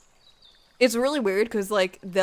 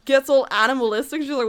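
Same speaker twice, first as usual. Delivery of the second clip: ordinary delivery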